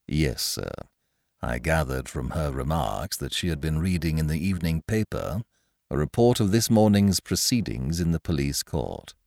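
calm, deferential butler reply; Jeeves. A narrator's reading. Valet calmly advising his master to leave London. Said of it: "Jeeves explaining".

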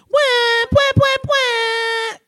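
high, slow wah-wah sound effect; loud sad trombone noises; mechanical crying doll. Retold or imitated. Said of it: "I'd press a button and it'd be like".

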